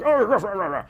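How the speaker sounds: garbled growly mock cursing. Preacher mimicking Peter cursing at the woman, mock cursing. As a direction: imitate a cursing rant in nonsense syllables. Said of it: "he cursed, like".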